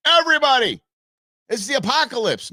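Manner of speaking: high, loud hammed-up doom cry; mock alarm. Host mimics what he is not saying, mock panic. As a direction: parody an alarmist warning the whole crowd.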